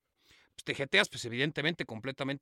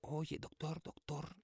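whispered coaxing "doctor, doctor"; soft, gentle wake-up call; 12 dB quieter, much slower than usual. Retold or imitated. Gently waking the sleeping doctor, hushed. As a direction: ease him awake softly. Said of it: "así suavecito".

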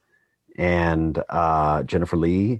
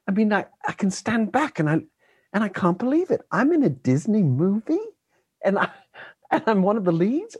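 put-on higher young boy voice, full of wonder; imitating a kid; 11 semitones higher than usual. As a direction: voice the child actor amazed at his luck. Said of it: "he's like".

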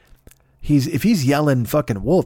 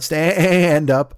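drawn-out, bleating "stand-up"; stand-up as a sheep would say it; 3 semitones higher, 4 dB louder than usual. Acted. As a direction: stretch the vowel into a wavering baa. Sheep doing stand-up, bleated word.

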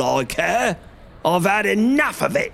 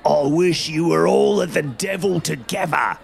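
a furious market trader, higher and far; angry salesman; 5 semitones higher than usual. A narrator's reading. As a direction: shout him away in anger. Angry salesman shouting at man.

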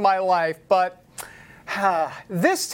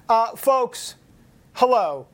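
normal talking voice

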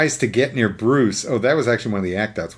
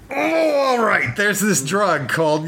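much higher, slow grudging villain voice; Joker giving in; 10 semitones higher than usual. Retold or imitated. The villain giving up the drug name, high and slow. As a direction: give in with a high, drawn-out sigh of a villain. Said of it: "And Joker goes".

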